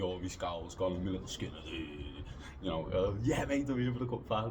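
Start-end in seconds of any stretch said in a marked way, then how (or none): 3.2-4.5 loud, high eager reply; dead excited. Retold or imitated. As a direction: re-enact a thrilled young fan answering. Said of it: "Dead excited".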